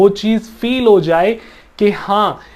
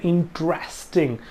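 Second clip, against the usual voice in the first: "Interesting" stressed on its "resting" part; "interesting" with the weight after the first syllable; word stress; 6 dB quieter than usual. Shown as a demonstration. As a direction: softly demonstrate "interesting", leaning on "resting". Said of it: "resting पे ऊपर जो stress किया है".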